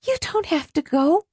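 put-on high, light girl's voice; high-pitched young woman consoling; soothing daughter's voice. A narrator's reading. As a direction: reassure Mom in a high voice. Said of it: "Alice was saying".

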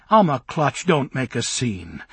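ordinary delivery, nothing marked

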